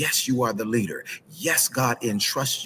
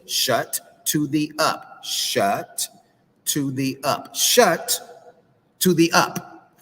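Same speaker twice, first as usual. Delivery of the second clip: slow, punched chant, building higher and louder; drawn-out coaching; 5 semitones higher, 3 dB louder, much slower than usual. Retold or imitated. Counsellor gets both to say it with him, slow emphatic drill. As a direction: lead the drill word by word, with gaps.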